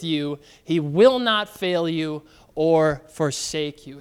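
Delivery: slow, emphatic pulpit reading; scripture reading. Acted. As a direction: proclaim it slowly like holy writ. Pastor reads scripture aloud.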